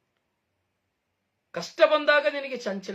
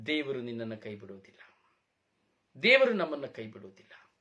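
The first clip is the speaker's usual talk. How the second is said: ordinary talk, nothing marked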